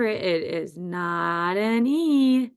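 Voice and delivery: "it is not an E" very slow, words stretched; drawn-out reminder. Shown as a demonstration. Teacher reminding first graders of the spelling, drawn out.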